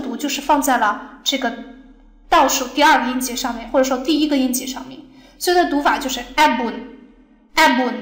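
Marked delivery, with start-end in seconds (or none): 6.3-7.9 abun with a heavy A; stress on first syllable. Shown as a demonstration. Teacher reading the example, first syllable stressed. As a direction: hit the A of abun, let bun drop. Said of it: "这个音是非常重的".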